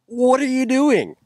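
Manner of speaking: high, drawn-out mock yell; retold yell. Storyteller re-enacting yelling at an actor over a stove fire, mock yell. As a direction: re-enact a stretched, high-pitched exasperated yell. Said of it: "and I yell that actor's name".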